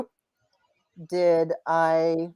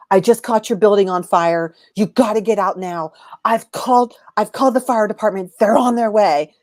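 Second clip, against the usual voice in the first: urgent warning; 3 semitones higher, 5 dB louder than usual. Retold or imitated. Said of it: "he says".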